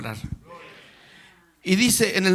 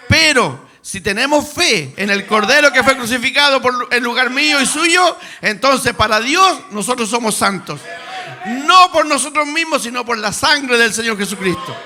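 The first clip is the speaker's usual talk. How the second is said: loud, rising pulpit cry; fervent preaching; 3 semitones higher, 6 dB louder than usual. Acted. Preacher proclaiming salvation to the congregation, loud and fervent. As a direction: proclaim it to the whole church with fire.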